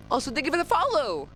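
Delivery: high bright thank-you; excited thanks. Real, not acted. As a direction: gush thanks to the follower. Streamer thanks a follower.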